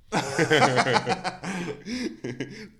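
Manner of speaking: long, high burst of laughter; loud laughter. Real, not acted. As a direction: crack up loudly at your own joke. Laughs at own bridesmaid joke.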